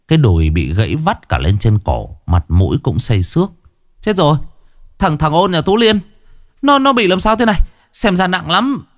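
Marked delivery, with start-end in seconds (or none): 4.0-8.8 alarmed villager. Acted. Villager finds crash victim.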